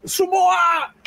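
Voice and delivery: high-pitched mock scream; strained high holler; Umaga. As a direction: imitate a wrestler's screamed war cry. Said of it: "Umaga just starts screaming".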